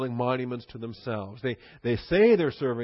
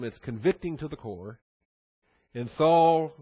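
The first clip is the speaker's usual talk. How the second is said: ordinary talk, nothing marked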